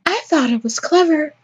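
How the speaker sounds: high, put-on protesting voice; high-pitched joker character. Joker defending the skeleton pun, high character voice.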